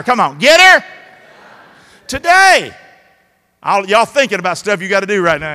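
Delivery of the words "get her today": high, loud shout of the Southern saying; louder call. Shown as a demonstration. Preacher leading the crowd in a louder shout. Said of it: "Say it louder".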